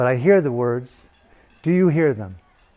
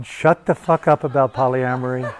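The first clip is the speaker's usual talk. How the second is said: usual speaking voice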